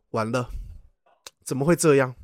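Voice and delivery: low, quiet mock dismay; hushed, retold self-talk; muttering to himself. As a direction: murmur it to yourself, imitating a worried dad. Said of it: "自言自语了起来".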